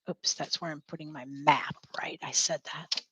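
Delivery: whispered self-correction; whispered to herself. Real, not acted. Teacher to herself over the map, a whispered aside. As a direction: drop to a whisper to correct yourself. Teacher catching her own slip.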